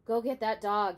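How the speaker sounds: loud, firm call across the church; yelled order. Acted. Preacher yelling at Opal over the howling, loud order. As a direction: yell it over the noise. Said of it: "he yelled".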